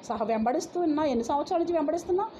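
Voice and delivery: ordinary talk, nothing marked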